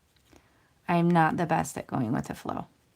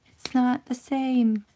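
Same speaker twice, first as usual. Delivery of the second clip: higher, urgent mock plea from a viewer; imagined viewer warning; 8 semitones higher, 5 dB louder, much slower than usual. Viewer begging the crafter not to cut yet, higher. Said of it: "were you saying to yourself".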